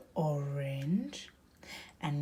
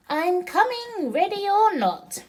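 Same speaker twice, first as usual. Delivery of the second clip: higher, louder playful call of a seeker; Splosh calling out; 13 semitones higher, 10 dB louder than usual. Acted. Mom voicing Splosh for her daughter, high and loud. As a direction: sing it out like a duck counting down for hide and seek. Splosh calls in seek game.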